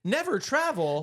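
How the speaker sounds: high, disbelieving echo; mock astonishment at her claim; incredulous. Real, not acted. Partner claims they rarely travel.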